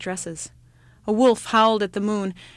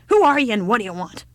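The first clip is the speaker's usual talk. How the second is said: shouted; 2 semitones higher, 7 dB louder, faster than usual. Acted. Old woman shouting at the child on her doorstep, loud. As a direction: bark it like a deaf old woman. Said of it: "shouted the old woman".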